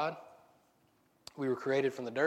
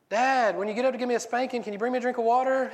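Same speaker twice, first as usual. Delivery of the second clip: put-on much higher, louder pleading kid; whiny kid plea; 9 semitones higher, 5 dB louder than usual. Kid begs dad for water, high whiny call. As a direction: whine up at dad from bed.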